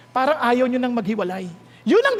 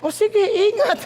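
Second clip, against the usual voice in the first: high-pitched, put-on sweet goodbye; mock lover's farewell with a laugh in it; hypocritical farewell; 6 semitones higher, slower than usual. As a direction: act out a fake-caring send-off. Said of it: "Napaka-hipokrito".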